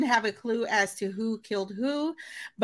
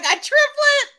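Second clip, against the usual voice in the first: high squeaky disbelief, louder; incredulous; 14 semitones higher, 7 dB louder, slower than usual. Retold or imitated. Fan mocking her own shocked reaction, high and incredulous. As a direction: gasp out the word in delighted shock. Excited disbelief at a plot twist.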